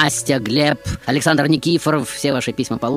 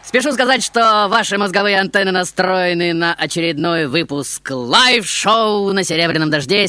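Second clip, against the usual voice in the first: excited showman host; 9 semitones higher than usual. Acted. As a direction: greet the crowd like a manic host. High showman host greeting.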